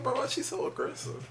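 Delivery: high-pitched puzzled quote; high, incredulous retelling; retold bafflement. Retold baffled reaction to a text.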